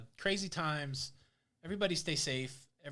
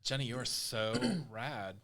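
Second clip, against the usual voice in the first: put-on slow, lower Valley girl parody; 4 semitones lower, slower than usual. Host teases co-host in slow, mock Valley girl accent. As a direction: drag out the Valley girl slang. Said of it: "talking like a valley girl".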